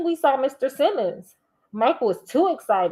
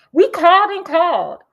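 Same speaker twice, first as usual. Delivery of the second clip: louder, higher worried dad; raised voice; 7 semitones higher, 8 dB louder than usual. A narrator's reading. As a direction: call it out in alarm. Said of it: "his voice rising".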